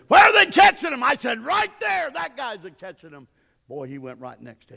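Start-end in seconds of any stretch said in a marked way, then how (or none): 0.1-3.3 high, loud holler; calling out. Retold or imitated. Friend asking where they are catching fish, hollered. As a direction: shout it across the water. Retold calling out at fishing spot.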